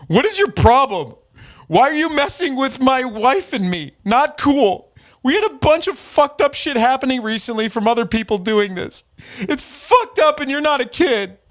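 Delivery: high, pleading, upset man's voice; his whiny voice. Retold or imitated. Streamer reads the troll's complaint aloud in a high, wounded whine. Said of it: "I'm gonna read it just as he, uh, would sound".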